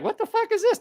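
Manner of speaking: high incredulous squeak, pitch shooting up; stunned viewer at a weird video, mock disbelief. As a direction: play the baffled viewer clicking in shock. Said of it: "they're like".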